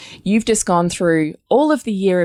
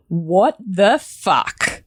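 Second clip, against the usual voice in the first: slow disbelieving what the fuck; much slower than usual. Retold or imitated. Wife recalling her shock at husband's rant, slow and stunned. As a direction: drag it out, stunned. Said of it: "I'm like".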